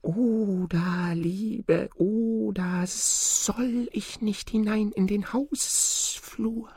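high, slow, drawn-out singsong snake voice; pleading snake. A narrator's reading. Snake begs Oda to let it into the hallway, pleading. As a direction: stretch every vowel high and soft, like a begging snake. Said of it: "da fing die Schlange an zu sprechen".

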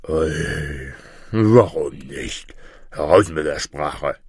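low monster bird voice; deep, demanding creature; impatient griffin. Acted. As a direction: agree grandly from down deep. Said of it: "rief der Vogel Greif".

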